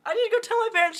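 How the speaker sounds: bursting to share the news; excited retelling. Retold or imitated. Retelling rushing to tell parents.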